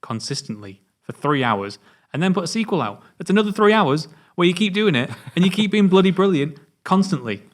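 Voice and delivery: escalating awe, high and loud at the end; rising amazed rant. Retold or imitated. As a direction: rave with mounting amazement. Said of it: "You go".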